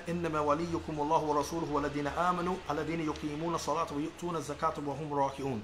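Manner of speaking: rapid, flowing Arabic recitation of the verse; fast Quranic recitation in Arabic. Acted. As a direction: recite it quickly in one breath, like a practiced reciter.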